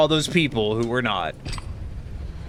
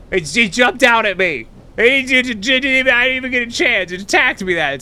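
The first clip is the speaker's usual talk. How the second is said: high, stammering fright; real startled stammer, much higher and louder; startled panic; 11 semitones higher, 5 dB louder than usual. Real, not acted. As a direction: blurt it out, shaken after the jump scare. Startled by something jumping out.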